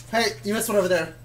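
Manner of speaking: ordinary talk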